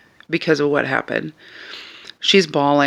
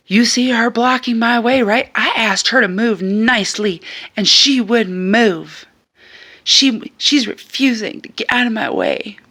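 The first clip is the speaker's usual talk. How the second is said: whiny high appeal; 7 semitones higher, 3 dB louder than usual. Retold or imitated. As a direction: play the wronged party to the crowd. Woman appealing to bystanders.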